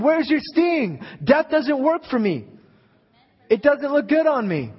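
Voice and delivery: triumphant preacher's taunt; defiant taunt. Acted. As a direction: throw the taunt right in death's face. Jesus voiced taunting death.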